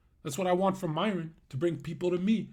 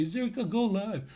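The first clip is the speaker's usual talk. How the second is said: higher-pitched imitation of a pleading fan; mock needy plea; 4 semitones higher than usual. Streamer imitates viewers with a bad feed, mock needy plea. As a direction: mimic a needy viewer, lightly. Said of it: "You won't say it like a".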